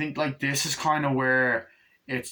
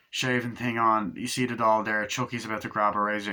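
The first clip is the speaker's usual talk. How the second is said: ordinary talk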